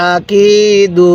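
আকিদু very slow, কি held long and level; one-alif elongation. Shown as a demonstration. Teacher showing how to read, drawn-out আকিদু. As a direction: chant আকিদু, stretching the middle কি. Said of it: "এক আলিফ টানতে হয়".

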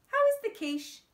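eager. Acted. Flip eager question.

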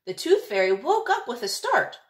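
usual speaking voice

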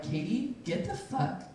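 hushed, slow self-command; inner voice. Retold or imitated. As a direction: whisper a stern order to yourself. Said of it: "in my head I'm like".

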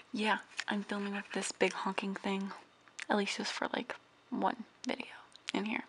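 soft whispered aside; hushed voiceover whisper. Real, not acted. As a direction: whisper so nobody wakes. Whispering while filming indoors.

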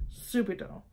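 subito quietly, stress on SU; the proper Italian subito; subito, SOO-bee-to; correct Italian. Shown as a demonstration.

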